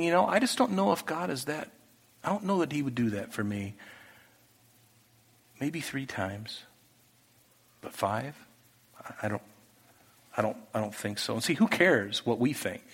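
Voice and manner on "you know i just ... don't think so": doubting to himself. Retold or imitated. King doubting God in thought.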